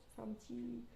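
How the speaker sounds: usual speaking voice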